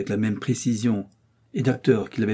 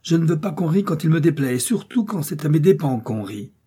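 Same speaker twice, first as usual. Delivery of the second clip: high, loud heated retort; angry cry; 6 semitones higher, 3 dB louder than usual. A narrator's reading. D'Artagnan refuses to be laughed at, angry. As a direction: press the point furiously. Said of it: "s'écria D'Artagnan".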